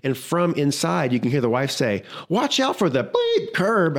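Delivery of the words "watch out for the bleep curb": high, slow mock yell at a husband; retold yell. Wife in the truck yelling at her husband, mock yell. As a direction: scold him like the angry wife. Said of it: "she's yelling at her husband".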